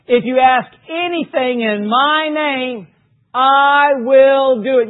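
slow build to a shouted I will do it; emphatic verse. Acted. Preacher hammering the verse to the congregation, slow then shouting. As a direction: drive the promise home, slowly then shouting.

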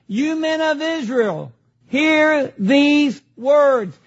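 "hear these words" stretched very slowly; emphatic address. Acted. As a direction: proclaim it, each final word set apart. Reading out Peter's address emphatically.

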